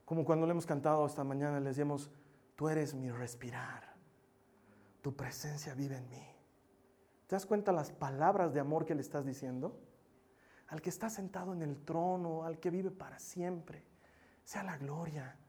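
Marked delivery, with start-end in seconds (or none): 2.6-6.3 tender worship words. Retold or imitated. Quoting worship song lyric. 10.7-15.4 soft worship words. Retold or imitated. Worship lyric quoted softly.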